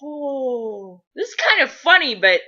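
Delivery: hesitant, high aside to a brother; Gabe. Acted. Gabriel beside frantic Balthazar, mildly amused and hesitant. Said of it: "And Gabe is going".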